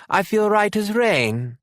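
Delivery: a little lad swearing he's fine; young son insisting to his mother he's well, high and slow; small boy insisting. A narrator's reading. Narrator voices small British boy.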